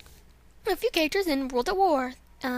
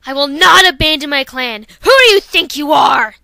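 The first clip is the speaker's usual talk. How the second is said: furious refusal; 13 dB louder than usual. Acted. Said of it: "here's Dawn Flash".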